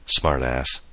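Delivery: plain reading voice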